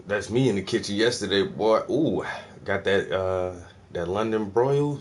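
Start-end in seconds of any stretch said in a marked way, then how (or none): none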